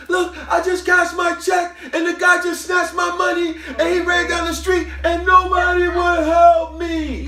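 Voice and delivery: high, loud, slow screamed account; screaming. Retold or imitated. Robbed woman screaming her story to the security guard, high, loud and slow. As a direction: wail it to the guard, slowing down at the end. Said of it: "she's screaming".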